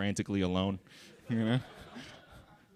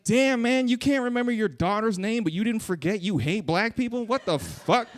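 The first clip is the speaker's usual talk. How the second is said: high, loud disbelieving outburst; outraged disbelief at grandma; incredulous mock rant; 12 semitones higher, 5 dB louder than usual. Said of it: "I was like".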